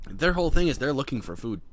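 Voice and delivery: usual speaking voice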